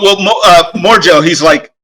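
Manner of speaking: normal talking voice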